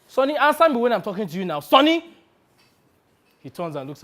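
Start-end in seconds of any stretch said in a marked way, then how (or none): 0.1-2.1 raised, fast demand, then calling out loud; impatient demand. Acted. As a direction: demand attention, higher and louder to the name. Calls to agent ignoring him.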